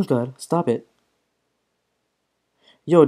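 normal talking voice

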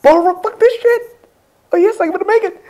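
very high falsetto squeal; excited squealing. Real, not acted. Player about to make it in game, excited squeal. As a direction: squeak it out frantically.

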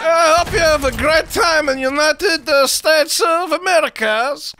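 mock Kazakh accent; Borat. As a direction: put on a comic foreign-visitor accent. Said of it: "Yo, Borat".

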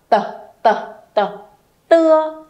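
"t" repeated crisply, then "tưa"; initial sound drill. Shown as a demonstration. Teacher drilling an initial consonant for the class, crisp and clear. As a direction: tap out the initial "t" before the syllable "tưa". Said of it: "Tiếp theo với âm".

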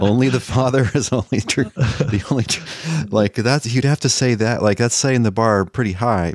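high-pitched, incredulous half-laughing voice; amused disbelief. Real, not acted. Co-host mocking the other side's argument, amused disbelief. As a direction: scoff through laughter at an absurd demand.

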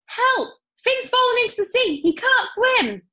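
high, loud urgent call; shout for help. Acted. Jazz shouting to Mister Munro for help, high and loud. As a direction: yell for help across the water. Calling for help across harbour.

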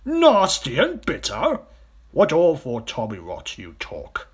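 loud, slow, incredulous outburst; crocodile's scornful cry. A narrator's reading. Enormous crocodile dismissing the smaller one's claim, loud and scornful. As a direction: start high, come down in scorn. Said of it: "cried the enormous crocodile".

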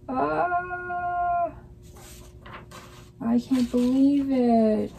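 high, slow dismayed wail; real frustrated disbelief; dismayed groan. Real, not acted. Dismay at printing upside down.

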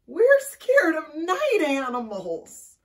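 high, loud, drawn-out answer; frightened wail. A narrator's reading. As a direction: wail it as scared little animals. Frightened animals answer bat.

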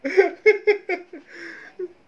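high, loud laughing fit; real laughter. Real, not acted. Vlogger reacting to the slow Devon joke, high loud laughter.

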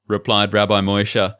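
usual speaking voice

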